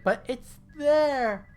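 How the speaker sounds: high, slow, incredulous squeal; a pleading cry of disbelief, hammed up; mock protest. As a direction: whine it like a baffled comic insisting. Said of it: "And he's like".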